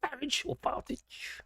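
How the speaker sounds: a sneering aside, breathed not voiced; mock-secret jeer; mocking whisper. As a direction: whisper the pun on the name, slow and sly. Mocking Farage's name.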